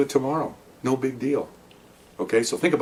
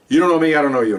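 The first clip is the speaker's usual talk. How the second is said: getting pissed; 6 semitones higher, 5 dB louder than usual. Real, not acted. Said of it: "Now I'm getting pissed".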